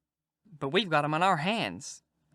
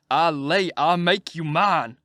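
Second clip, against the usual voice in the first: put-on slow, louder threat to the child; boiling mad; 7 dB louder, slower than usual. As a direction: grind out the threat in anger. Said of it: "I was a billin'".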